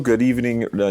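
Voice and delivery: ordinary delivery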